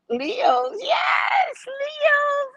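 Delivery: high, cheering call to the Leos; excited shout-out. Real, not acted. Cheering on Leo viewers.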